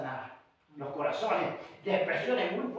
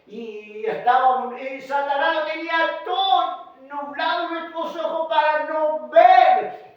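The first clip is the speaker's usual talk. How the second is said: raised, sing-song preacher's cadence; impassioned preaching; 7 semitones higher, 9 dB louder, slower than usual. Real, not acted. Preacher telling how the Master restored sight, impassioned preaching. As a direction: preach it with long, stretched key words.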